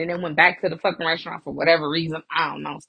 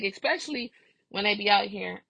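normal talking voice

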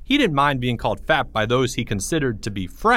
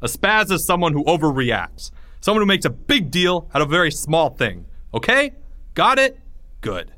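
stern teacher; 6 semitones higher than usual. Acted. Teacher stern after outburst.

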